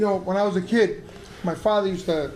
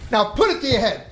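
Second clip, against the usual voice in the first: firm commanding father; acted-out forceful instruction; mock stern order; 4 dB louder than usual. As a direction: order the kid without room for argument.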